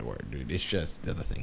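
low, soft easygoing "word, dude"; low forgiving mumble. Retold or imitated. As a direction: let it go casually. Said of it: "I was like".

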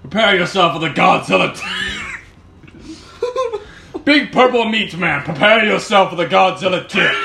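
loud shouted hype; infomercial. Pretend. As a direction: shout it like a wrestling promo.

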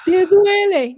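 high, loud teasing question; taunting brother. Retold or imitated. Brother gloating over injured sister, loud high tease. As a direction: poke at her pain like a gloating sibling. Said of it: "mi hermano".

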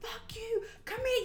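high mock yell; angry cousin. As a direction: shout it at the strangers. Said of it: "He's just going".

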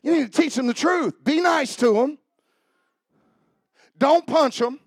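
loud, high preaching voice pressing each command; fiery preaching. Acted. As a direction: preach it hard to the congregation. Preaching at full force.

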